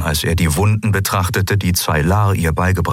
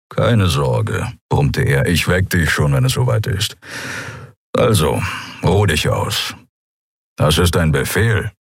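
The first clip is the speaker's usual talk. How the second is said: grumbling Keru; 2 semitones lower than usual. A narrator's reading.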